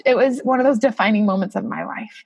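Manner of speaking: ordinary talk, nothing marked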